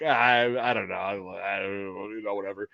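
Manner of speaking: low, soft mock indifference; noncommittal mumbling. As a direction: trail into a half-hearted brush-off. Acting noncommittal younger self.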